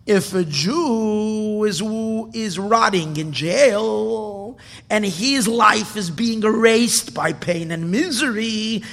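slow, rising fervent sermon; loud impassioned sermon voice; impassioned preaching. Acted. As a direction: preach it with mounting passion. Preacher's climax.

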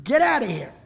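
loud, high burst of anger; acted furious outburst; shouted dismissal. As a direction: throw him out with a shout. Rebbe banishes the impure visitor.

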